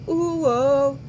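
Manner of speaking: ordinary delivery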